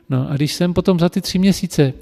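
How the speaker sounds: ordinary delivery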